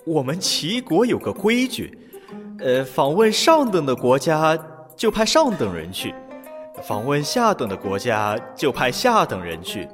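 mock resigned sigh; feigned helplessness. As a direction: play helpless with a shrug. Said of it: "假装无奈地说".

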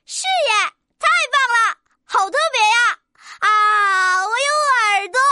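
excited little rooster rejoicing; happy cheering. Acted. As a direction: cheer with childlike delight. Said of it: "小公鸡开心地欢呼起来".

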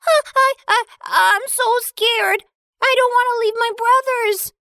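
high, stammering, frightened voice; stutter, pleading not to go; trembling with fear. Acted. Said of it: "trembled with fear".

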